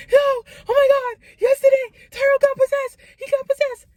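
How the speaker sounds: rushing downstairs to tell the parents, frantic and high; panicked. Retold or imitated. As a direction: blurt it out in a panic.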